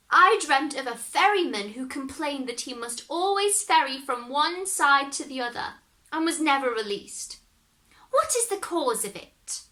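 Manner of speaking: a cunning grandmother spinning her tale; grandmother telling a dream. Acted.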